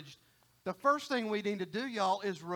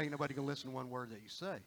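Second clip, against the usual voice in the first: low, soft and rushed; quick, quiet throwaway as God; offhand aside; 7 semitones lower, 8 dB quieter, much faster than usual. Acted. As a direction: slip the catch in quickly under your voice. God's offhand catch to the calling.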